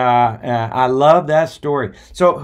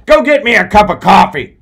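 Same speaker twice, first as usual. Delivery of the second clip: pissed-off boss; 7 semitones higher, 10 dB louder than usual. Retold or imitated. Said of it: "the guy gets pissed off".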